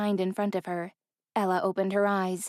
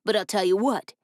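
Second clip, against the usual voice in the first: gruff threat. A narrator's reading.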